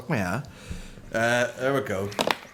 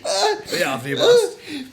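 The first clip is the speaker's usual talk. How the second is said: laughing; 11 semitones higher, 3 dB louder than usual. Pretend. Mock Santa while laughing.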